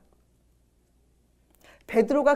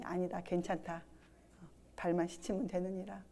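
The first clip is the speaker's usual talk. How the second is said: soft, lower voicing of Jesus; gentle Jesus voice; 3 semitones lower, 13 dB quieter than usual. Preacher voicing Jesus gently refusing Peter, soft and low. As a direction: speak softly as a kind master.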